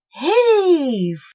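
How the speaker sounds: loud heaving grunt of a word; effort cry. Acted. Cobwobblies straining to drag the snail. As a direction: heave with all your might.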